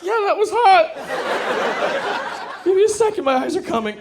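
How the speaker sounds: hurt guy squeaking that it was hot; strained squeak. Retold or imitated. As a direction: fake enthusiasm through pain. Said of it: "I'm like".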